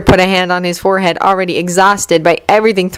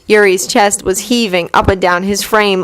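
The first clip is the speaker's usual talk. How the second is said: ordinary delivery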